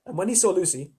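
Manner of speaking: ordinary delivery, nothing marked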